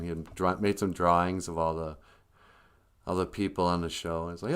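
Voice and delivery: ordinary talk, nothing marked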